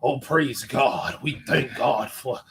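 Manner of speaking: slow, lightly voiced preacher. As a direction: ease into a preacher's drawl. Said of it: "a good preacher voice".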